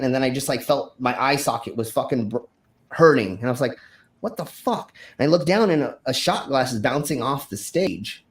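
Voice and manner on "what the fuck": higher, softer bewildered question; mildly reliving the disbelief; retold shock. Performer feeling his hurt eye, mild retold shock.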